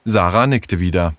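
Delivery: ordinary delivery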